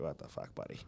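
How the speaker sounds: whispering. Retold or imitated. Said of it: "I was like".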